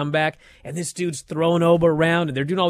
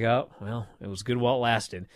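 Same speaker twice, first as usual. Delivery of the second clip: resigned sigh; 5 semitones lower, 8 dB quieter than usual. Retold or imitated. Resigned fan reaction.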